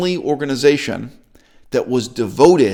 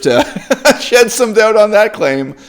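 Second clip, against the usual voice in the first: high, louder words through real laughter; laughing scepticism; 8 semitones higher, 4 dB louder than usual. Real, not acted. Laughs at the dubious claim.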